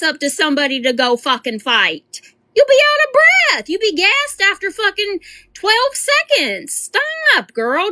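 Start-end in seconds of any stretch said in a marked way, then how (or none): 2.5-6.7 high, squeaky mocking jab; mocking squeal. Streamer mocking the girl for being out of breath, high squeal. 6.7-7.9 high, exasperated stop; exasperated squeal. Real, not acted. Streamer begging the girl in the clip to stop, high squeal.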